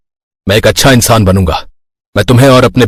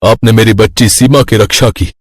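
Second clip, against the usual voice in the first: ordinary delivery, nothing marked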